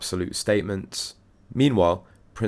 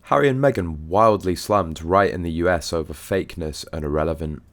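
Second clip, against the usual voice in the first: usual speaking voice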